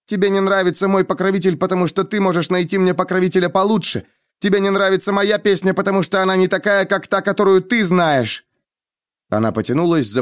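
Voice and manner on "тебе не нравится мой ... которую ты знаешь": high-pitched insistent tirade; angry accusation. A narrator's reading. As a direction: hammer out each grievance heatedly. Denna lists angry accusations. (гневно обвиняя, высоким голосом)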